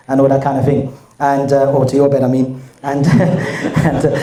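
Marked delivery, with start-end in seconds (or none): 3.1-3.8 breathless silent laugh into the mic; real laughter. Real, not acted. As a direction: laugh helplessly, almost no voice. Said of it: "you see how that is dangerous in the mic".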